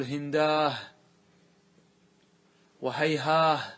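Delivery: هنداه and هيهاه end on a breathy aah, slowly; rare dialect form. Shown as a demonstration. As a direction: stress the final h in هنداه. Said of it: "يقلبها إلى هاء".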